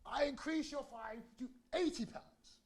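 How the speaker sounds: lightly voiced stern judge; hint of a pompous magistrate; mock judge. Judge raising the fine.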